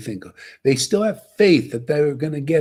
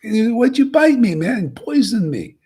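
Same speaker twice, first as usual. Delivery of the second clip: higher and louder, a mock cry of betrayal; bitten man's outcry; 8 semitones higher, 6 dB louder than usual. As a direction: yell it like you've just been bitten. Bitten man cries out at snake.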